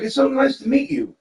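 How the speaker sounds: high, sweet greeting in a bright put-on voice; imitating an overly sweet hug-giver; sugary goody two-shoes. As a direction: gush it like the nicest girl alive. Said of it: "a little goody two-shoe".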